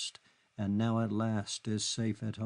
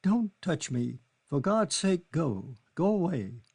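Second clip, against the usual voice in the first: higher, raised, desperate begging; desperate plea; 6 semitones higher, 4 dB louder than usual. Acted. Kitty begging Charlie to stop touching her. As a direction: push him off with your voice.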